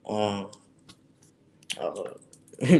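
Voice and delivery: echoey room sound. Real, not acted. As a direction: voice echoing in the room. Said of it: "sorry for the echo there".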